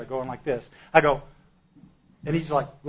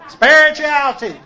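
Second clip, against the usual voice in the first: much higher and louder, sing-song mockery; 12 semitones higher, 13 dB louder than usual. Newcomer mocks everyone's talk of spirituality, high and loud. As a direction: stretch it out high and mocking. Mocking buzzword, laughing.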